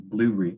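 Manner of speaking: blueberry squashed to "BLOO-ree"; swallowed word. Shown as a demonstration. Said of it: "We sort of swallow the word".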